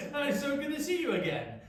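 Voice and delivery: high, loud laughing hello; laughing reunion. Retold or imitated. Brothers reunited, greeting with a delighted laugh. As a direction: laugh through a joyful welcome. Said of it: "that's how they would have".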